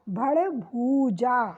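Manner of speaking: भड़ भूजा said slowly, stretching the ऊ in भूजा; भूजा with a long drawn ऊ; sounding out the word. Shown as a demonstration. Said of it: "होता क्या है?". (धीमे से, भूजा में लंबा ऊ खींचकर)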